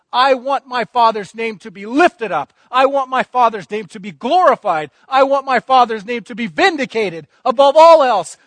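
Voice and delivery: fervent preaching. Acted. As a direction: lift it up like a pulpit climax.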